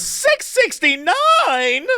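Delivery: high, very drawn-out outraged disbelief; mock incredulous shriek. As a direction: repeat the price as an exaggerated, rising squeal. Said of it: "And the woman goes".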